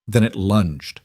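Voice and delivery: plain reading voice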